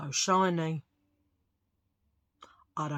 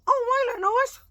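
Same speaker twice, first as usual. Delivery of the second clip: very high, louder delighted mock voice; greedy squeal; 7 dB louder than usual. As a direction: squeal over tasty nuts. Retold greedy thought about nuts.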